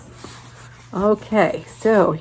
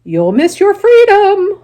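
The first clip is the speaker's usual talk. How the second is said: very high, loud, slow honk; the goose pleads with Wilbur, high, loud and slow; 13 semitones higher, 10 dB louder, slower than usual. Acted. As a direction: honk it out slowly. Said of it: "honked the goose".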